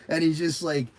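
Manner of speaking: ordinary delivery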